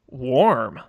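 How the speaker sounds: low, one-word mock verdict; deadpan. Co-host reviewing a cup of coffee, deadpan. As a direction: answer with no feeling at all. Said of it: "completely deadpan".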